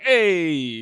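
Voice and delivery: put-on loud, high Italian accent "Hey!". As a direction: belt it like a loud Italian showman. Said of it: "a really thick Italian accent".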